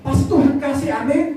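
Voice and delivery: high-pitched, driving sermon delivery; fervent preaching. Real, not acted. Preacher urging congregation toward eternal life, fervent preaching.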